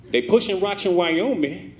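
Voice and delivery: high, strange street hustler voice; crackhead voice. Pretend. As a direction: voice a crackhead on FaceTime. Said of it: "That's my crackhead voice".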